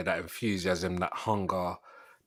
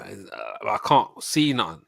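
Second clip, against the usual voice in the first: usual speaking voice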